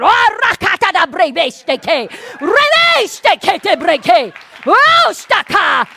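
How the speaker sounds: loud, slow speaking in tongues; fervent glossolalia; shouted tongues. Retold or imitated. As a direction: pray in tongues like going to battle. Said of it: "I started going".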